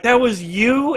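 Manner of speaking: high, incredulous mock question; retold amazement. Friends reacting to his running up, retold amazement. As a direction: act out astonished disbelief, voice jumping up.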